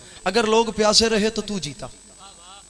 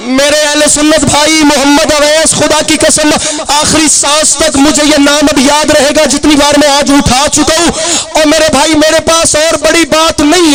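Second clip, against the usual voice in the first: impassioned preaching; 7 semitones higher, 16 dB louder than usual. Acted. Preacher hurling Husain's challenge at the enemy, impassioned. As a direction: call out to the crowd with full force.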